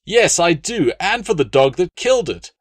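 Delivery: high, loud angry retort; snapped. Acted. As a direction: snap it back, too upset for manners. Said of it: "Appius snapped".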